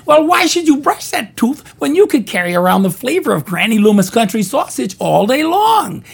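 put-on high old granny falsetto; Granny Loomis voice. Granny pitches sausage to girl in high voice. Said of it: "She says".

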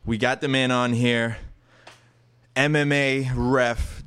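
loud hype show announcer. Acted. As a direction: boom it out like a ring announcer. Hype podcast intro over music.

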